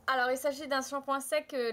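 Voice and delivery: ordinary delivery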